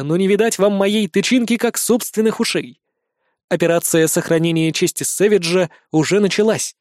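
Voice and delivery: plain reading voice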